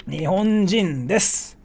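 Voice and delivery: normal talking voice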